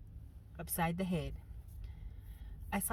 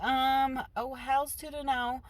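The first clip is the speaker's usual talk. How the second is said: sassy; 6 semitones higher, slower than usual. Retold or imitated. Mother pulls her hair.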